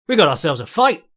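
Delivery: loud, rising announcer call, imitated; Big John catchphrase. Imitating Big John calling the fight, loud and rising. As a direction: ham up a commentator hyping the fight. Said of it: "all in the intonation".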